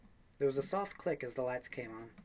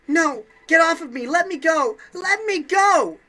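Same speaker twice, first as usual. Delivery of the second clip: much higher, much louder thrashing shrieks; frantic scream; 17 dB louder, slower than usual. Acted. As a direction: scream at him to let go. Jun fights Junpei's grip.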